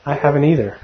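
ordinary delivery

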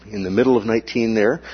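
ordinary delivery

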